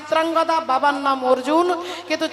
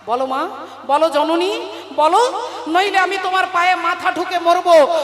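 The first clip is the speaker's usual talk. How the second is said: demanding son; 3 semitones higher than usual. Acted. Son angrily demands the truth.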